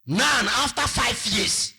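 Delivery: high, loud accusing outburst; loud reproach. Acted. Beggar reproaching artist in acted speech.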